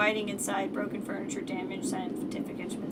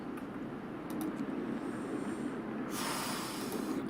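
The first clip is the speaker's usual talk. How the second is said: long tired sigh; 7 dB quieter than usual. Real, not acted. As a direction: let out a long weary sigh. Weary sigh.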